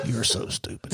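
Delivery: low, hushed mock disdain; silent judging look. Chief staring across the desk at sergeant, hushed mock disdain. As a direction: breathe it out low, voicing a withering look. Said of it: "he looking like".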